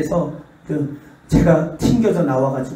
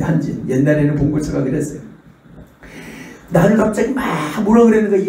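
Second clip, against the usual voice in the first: usual speaking voice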